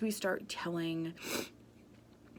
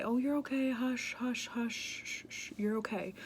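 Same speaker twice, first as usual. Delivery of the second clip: put-on high-pitched soothing of a crying child; hushing parent; 5 semitones higher than usual. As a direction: shush a baby with singsong reassurance. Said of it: "My default is to say".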